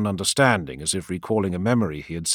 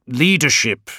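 clipped and louder, a sharp correction; curt, agitated; 7 semitones higher, 7 dB louder than usual. A narrator's reading. Leader correcting himself to his adviser, curt and agitated. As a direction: snap the word out, irritated. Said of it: "curt, agitated".

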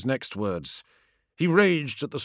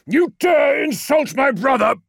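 furious high roar; outraged bellow; 13 semitones higher, 7 dB louder than usual. Acted. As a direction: roar in wounded fury. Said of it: "roared Magnus".

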